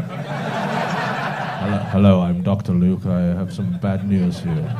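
hammed-up deep doctor delivering bad news; deep-voiced Doctor Luke. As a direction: play a grave doctor with a deep voice. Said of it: "Got a deep voice".

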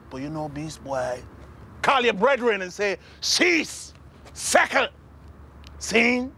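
high, punched Jamaican patois; Jamaican accent. Acted. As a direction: bark the commands in a Jamaican accent. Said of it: "I can do a Jamaican accent".

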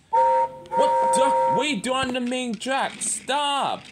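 high-pitched, rushed panic; alarmed cry. Acted. Alarmed driver before a crash.

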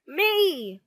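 high, loud eager me; screaming. A narrator's reading. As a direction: scream to be picked first. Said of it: "screamed Horrid Henry".

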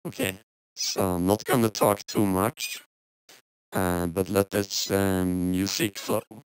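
robotic voice changer. Real, not acted. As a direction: speak through a robot voice filter. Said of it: "robotic voice reveal".

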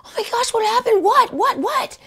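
high, frantic "what, what, what"; startled, grabbing for what happened; going crazy. Retold or imitated. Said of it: "I start going crazy".

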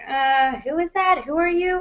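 high-pitched, puzzled mock onlooker; awkward confusion. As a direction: act out not recognizing someone, pitched well up. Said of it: "'cause then it's that awkward moment".

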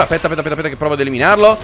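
ordinary talk, nothing marked

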